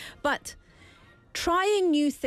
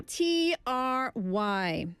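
slow spelling; much slower than usual. Shown as a demonstration. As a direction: spell it out very slowly, letter by letter. Spelling the word slowly.

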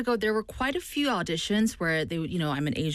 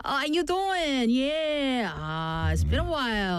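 higher-pitched, drawn-out New York phone greeting; New York accent; 6 semitones higher, slower than usual. Acted. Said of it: "on the phone with my New York friends".

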